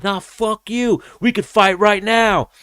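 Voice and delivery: hammed-up fighter screaming up the escalator; imitating a fighter challenging a heckler; shouting back.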